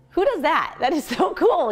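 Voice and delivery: high, delighted, with a laugh breaking in; can't believe her luck, laughing at it; excited. Real, not acted. Mom selling coaching perks, excited laughing high exclamation. Excited about getting paid to get fit.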